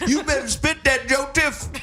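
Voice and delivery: high, squealing church auntie voice; hammed-up church lady. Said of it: "transported to a root scene".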